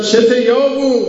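sharp high yell at the passer-by; retold shout. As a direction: bark it down from the carriage seat. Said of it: "این از این بالا داد زد".

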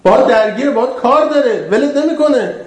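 high, urgent pulpit voice; impassioned preaching. Acted. Preacher warning the congregation that Satan is here, impassioned. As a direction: press the warning on the crowd.

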